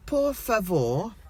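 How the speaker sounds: slow, high, over-enunciated pidgin Spanish; loud Brit abroad. Retold or imitated. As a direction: spell it out loudly for a foreign clerk. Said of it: "loudly as you do, being British".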